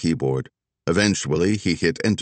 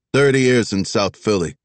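put-on boastful old cop, Philly accent; Philly accent, chest puffed out; proud Philly accent; 3 semitones higher, 4 dB louder than usual. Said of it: "Stan the Man added proudly".

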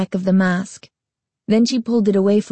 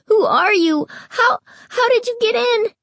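put-on high, stammering frightened boy; terrified. A narrator's reading. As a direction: stutter out the question in fear. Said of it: "his expression terrified".

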